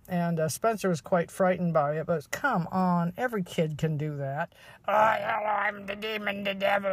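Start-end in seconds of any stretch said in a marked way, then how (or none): 4.9-6.9 a hint of ghastly demon, played for mockery. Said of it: "this ghastly voice".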